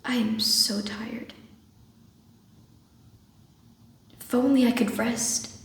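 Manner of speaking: soft, exhausted near-whisper; weary Nina. Acted. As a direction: let the words sink into long, tired pauses. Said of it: "playing the part of Nina".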